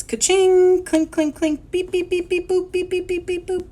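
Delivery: high, electronic-style beeps and clinks; cash register. Acted. Teacher imitates supermarket cash register, high beeping. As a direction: ring it up in high machine tones. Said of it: "buttons and the cash register open".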